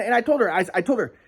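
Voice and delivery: usual speaking voice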